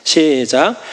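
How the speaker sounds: ordinary delivery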